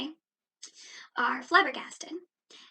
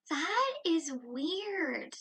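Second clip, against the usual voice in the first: slow emphatic verdict; 3 semitones higher, much slower than usual. Real, not acted. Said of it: "emphatic verdict".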